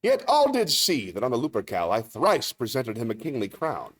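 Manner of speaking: theatrical funeral speech with measured pauses; stage actor's rhetorical monologue; Shakespearean oratory. Acted. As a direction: declaim to a crowd, swelling and falling back.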